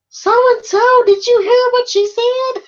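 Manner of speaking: imitating a guy's squeaky-high, loud excited question; loud mock falsetto, far above your own voice; voice shooting up. Retelling a classmate's outburst to the English teacher, high-pitched and loud. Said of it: "his voice went up about three octaves".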